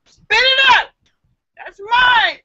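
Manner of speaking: high, loud angry yell. Acted. Irishman yelling at the fly in his pint, loud and high. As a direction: shake the fly and scream at it. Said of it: "while yelling".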